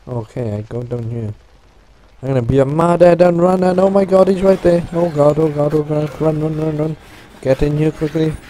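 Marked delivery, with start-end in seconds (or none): 2.2-8.4 panicked yelling while fleeing; loud, high frantic cries to run; being chased. Real, not acted. Fleeing a pursuer in game.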